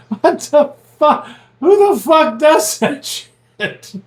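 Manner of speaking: high, drawn-out retold disbelief; incredulous outburst. Retelling his reaction to the prankster's stickers, high incredulity. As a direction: stretch it out high, aghast at the prank.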